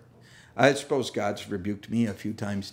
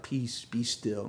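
calm mock serene; 7 dB quieter, slower than usual. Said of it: "And they're like".

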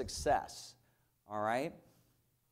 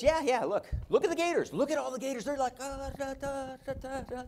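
higher, bouncy imitation of jumping players; excited wife; 11 semitones higher than usual. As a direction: mimic the hyped-up Gators. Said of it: "She goes".

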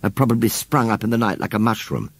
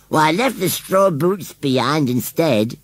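high, slow rustic voice; unabashed rustic scarecrow; 8 semitones higher, slower than usual. A narrator's reading. Scarecrow answers charge of stealing, unabashed. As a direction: play a scarecrow justifying himself.

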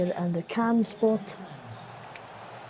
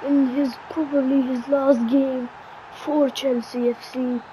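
lively TV match commentary; mock football commentator calling the match; play-by-play commentary; 4 semitones higher than usual. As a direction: call the action like a broadcaster. Self-commentating a football game.

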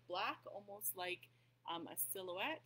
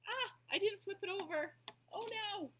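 sudden high, loud cry of dismay; dismayed surprise; 13 semitones higher, 9 dB louder than usual. Real, not acted. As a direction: caught a mistake mid-craft, blurt it out. Realises she painted wrong side.

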